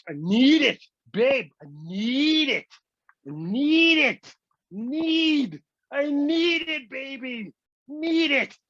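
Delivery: high, drawn-out mock begging; hammed-up addict's pleading; desperate craving. Acting craving to clip toenails.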